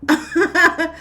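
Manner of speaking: short high chuckle; real incredulous chuckle; scoffing laugh. Real, not acted. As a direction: laugh it off in disbelief.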